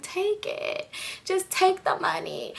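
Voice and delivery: soft, whispery high-pitched voice; hushed, high plea. Retold or imitated. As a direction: act out a faint, drawn-out surrender. Acts herself weakly handing over money.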